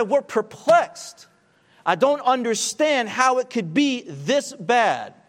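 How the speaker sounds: preached cry of perplexity, high and loud; voicing Paul's cry. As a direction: cry it out as the troubled apostle.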